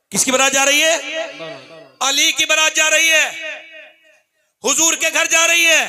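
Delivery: loud rousing call to the crowd; preaching at full force. Acted.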